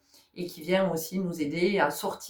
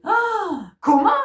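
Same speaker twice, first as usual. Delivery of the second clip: ah comment, plus aigu et plus fort; fausse surprise; 12 semitones higher, 8 dB louder, much slower than usual. Retold or imitated. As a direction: joue l'étonnement outré. Surprise feinte au tatouage. (surprise jouée, aiguë et forte)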